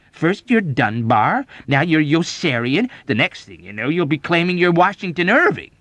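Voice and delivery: loud accusing lecture, climbing in pitch; emphatic, argumentative diagnosis; retorting. A narrator's reading. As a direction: scold him with mounting insistence. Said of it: "Major Sanderson retorted".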